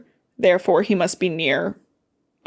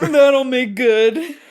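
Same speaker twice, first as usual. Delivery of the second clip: mock dim voice; 4 semitones higher, 5 dB louder, much slower than usual. Acting out Peeta.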